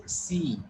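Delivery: "see" with a drawn-out ee; long E. Shown as a demonstration. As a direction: stretch the vowel in "see". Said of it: "The E sound is long sound".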